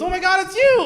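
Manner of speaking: high, loud gushing squeal; over-the-top clerk. Retold or imitated. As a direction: greet him like a long-lost star. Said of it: "she goes".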